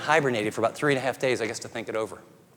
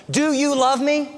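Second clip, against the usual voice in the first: put-on a child's higher, slow plea; child asking; 9 semitones higher, slower than usual. Child asking father, higher and slow. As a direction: ask it like a small child wanting reassurance. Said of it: "Your kids are always asking".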